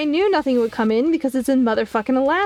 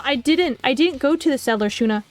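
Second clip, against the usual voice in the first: ordinary talk, nothing marked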